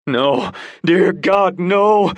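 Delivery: high, slow anguished cry. Acted. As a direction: bystander cries out in horror, high and anguished. Man at accident scene cries out.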